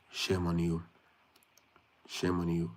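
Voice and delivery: ordinary delivery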